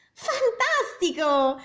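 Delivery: high, slow delighted cry; amazed princess. A narrator's reading. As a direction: praise the dragon to egg it on. Elizabeth cheers the dragon.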